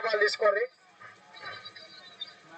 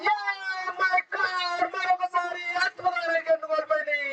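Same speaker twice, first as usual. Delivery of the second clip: high shouted wicket celebration; excited shout; 7 semitones higher, much slower than usual. Real, not acted. Wicket falls, commentator shouts.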